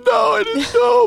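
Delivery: high, loud, tearful mother; mom voice. Retold or imitated. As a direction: squeal it like a crying mom. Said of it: "She said".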